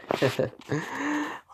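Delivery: high mischievous cackle; devilish laugh. Acted. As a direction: laugh like you're plotting trouble. Devilish grin on his face.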